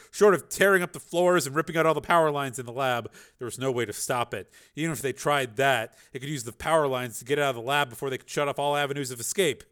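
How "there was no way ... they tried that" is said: ordinary delivery, nothing marked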